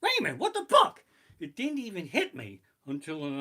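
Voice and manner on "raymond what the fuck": very high, loud shriek of terror; shrieking panic. Retold or imitated. Finally feeling the coaster terror, shrieking. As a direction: shriek out the fright. Delayed terror hitting later.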